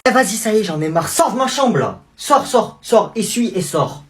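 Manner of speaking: fed up with the cousin, ordering him out. Acted. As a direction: throw him out of the room.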